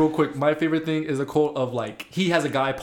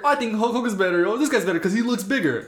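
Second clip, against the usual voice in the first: imitating fans; 7 semitones higher than usual. Mock fan opinion, old era.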